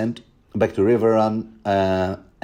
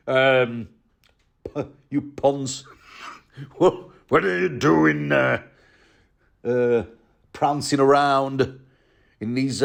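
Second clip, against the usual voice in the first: mock Great John; 4 semitones higher than usual. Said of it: "I'm just making things up".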